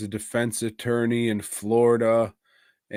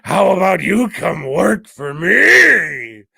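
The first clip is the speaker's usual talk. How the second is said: loud, higher, dragged-out gangster boss; Al Pacino impression; 6 semitones higher, 10 dB louder, slower than usual. Al Pacino's character offering him a job, loud hammy impression. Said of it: "And Al Pacino's like".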